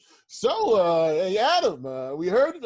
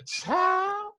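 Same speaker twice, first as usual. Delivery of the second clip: much higher Southern lady pitching a product; hammed-up church-lady sell, high and slowing; spoofing the chicken lady advertising the vaccine, much higher. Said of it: "He said".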